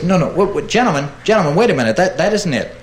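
Edison protesting. Acted. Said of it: "And Edison says".

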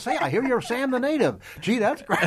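high, loud laughing caller; mock eager caller. Callers congratulating Bill on Sam the Native, laughing. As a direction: gush like a fan on the phone. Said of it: "getting all kinds of phone calls".

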